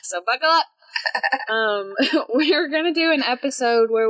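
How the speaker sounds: laughing. Real, not acted. Laughing at own confusion.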